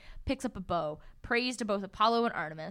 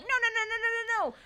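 high frantic string of no's; panicked pleading; 10 semitones higher, 4 dB louder than usual. Retold or imitated. Percy begging the hungry horses, high mock panic.

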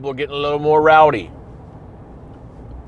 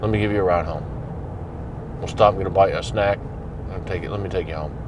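nice and friendly; 6 semitones lower, 6 dB quieter than usual. Retold or imitated. Officer offering the drunk guest a ride home, gentle and low. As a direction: lower and softer, easygoing and kind. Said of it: "if you engage nicely".

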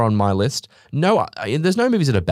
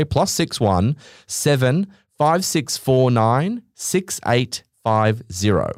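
slow, deliberate digit by digit; reading a number to listeners, slowly; much slower than usual. Real, not acted. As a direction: spell the phone number out carefully.

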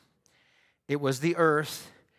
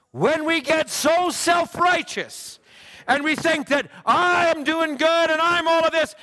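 much higher, louder, slow-building sermon cry; preaching at full force; 12 semitones higher, 5 dB louder than usual. Real, not acted. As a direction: climb into a fiery preacher's cry.

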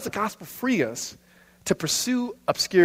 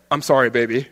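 low, mock bass-singer apology; deep voice; 5 semitones lower than usual. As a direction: put on a deep smooth-talker voice. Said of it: "one guy with the deep voice".

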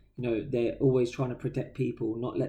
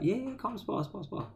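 high, keen come-on; a lightly hammed-up eager fighter; mock eager sparring mate; 8 semitones higher, 7 dB quieter than usual. Friend's mate inviting him to spar, high and eager. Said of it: "So he was like".

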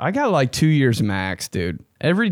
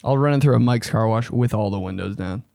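ordinary talk, nothing marked

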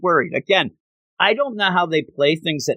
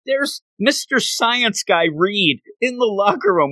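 mock coach; 8 semitones higher than usual. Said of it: "where the coach goes".

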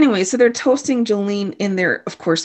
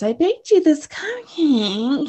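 higher, slow, syrupy offer; sugary fake sweetness; 6 semitones higher, slower than usual. Jolene offers Waverly a cupcake in a slow syrupy voice. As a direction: sweeten it to hide the trap. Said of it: "And then she says".

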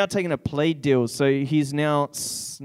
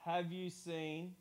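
repeating to voice assistant; 17 dB quieter than usual. Real, not acted. Asking phone assistant about movie.

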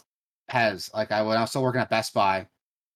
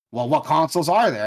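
louder, higher mock indignation; retold upset customer; 6 semitones higher, 8 dB louder than usual. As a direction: imitate an annoyed customer demanding an answer. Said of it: "he got, like, upset with me".

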